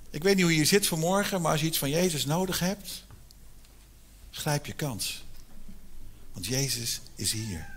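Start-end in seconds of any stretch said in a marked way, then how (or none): none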